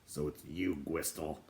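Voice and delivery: a hint of a grumpy dwarf; dwarf greeting an old acquaintance, lightly voiced; gruff dwarf. A narrator's reading. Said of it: "he said".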